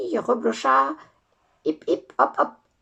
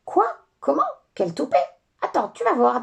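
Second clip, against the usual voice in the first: angry fairy; 5 semitones higher, 5 dB louder than usual. Acted. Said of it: "dit la fée en colère".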